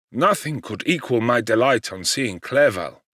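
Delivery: ordinary delivery, nothing marked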